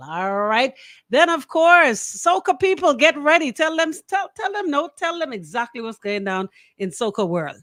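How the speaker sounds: ordinary talk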